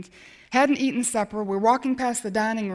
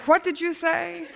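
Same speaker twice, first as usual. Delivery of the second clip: higher, slow, laughing disbelief; stunned disbelief; 8 semitones higher than usual. Retold or imitated. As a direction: ask back in amazed shock. Stunned at the offer.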